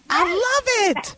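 high, drawn-out squeal of delight. Real, not acted. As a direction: stretch it out high, thrilled. Host delighted by guest's reveal.

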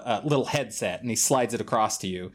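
ordinary delivery

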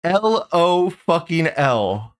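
"L O fucking L" high and slow, each word stretched; emphasize every word of "L O fucking L"; mocking over-emphasis. Streamer mimicking a leaf's emphasis for the show, "L O fucking L" drawn out. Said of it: "if the leaf couldn't emphasize it".

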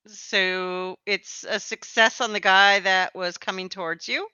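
usual speaking voice

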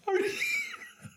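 shrieking laughing fit; high wheezing laugh. Real, not acted.

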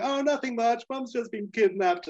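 high-pitched voice for a daughter; imitating Audrey. Audrey telling Rusty about Mum, high imitated girl's voice.

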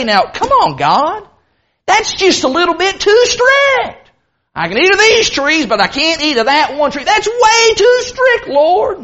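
high-pitched pretend complaint, climbing as it goes; mock protest. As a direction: whine to God in hammed-up objection, stretching the last complaint. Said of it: "Adam does not say".

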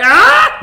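sudden high, loud "Ah!"; startled scream. Retold or imitated. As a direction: yelp at a giant bug. Retold shock at seeing giant roach.

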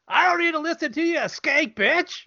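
high, quick put-on character voice; sassy retort in a high character voice; Franklin. Trading lines with co-host, high Franklin impression. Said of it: "I want Franklin".

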